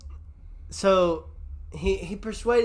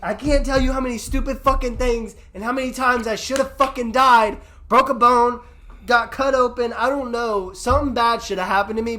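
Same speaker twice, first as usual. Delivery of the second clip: raised voice, high and loud; loud, higher-pitched, worked-up rant; emphatic venting; 7 semitones higher, 7 dB louder than usual. Real, not acted. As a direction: pile up the list with real exasperation. Vents about dangerous stunts he survived.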